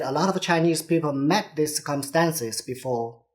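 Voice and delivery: ordinary talk, nothing marked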